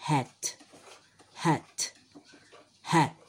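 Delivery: hat said short and clear with an aspirated h; model word. Shown as a demonstration. Teacher models hat for children, short and clear. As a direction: model hat for the class to repeat. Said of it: "A ver cómo te sale".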